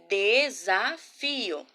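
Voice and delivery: desafio slowly split into de, sa, fio; syllable by syllable. Shown as a demonstration. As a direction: sound out desafio in stretched pieces. Teacher sounds out the word.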